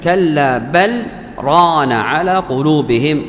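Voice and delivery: slow recitation, clear L in بل, brief stop before ران; clear iẓhār with sakt. Shown as a demonstration. Teacher reciting the verse to prove no merging, بل cut off before ران. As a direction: keep بل and ران separate with a small silence. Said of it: "فهو يقول بالإظهار".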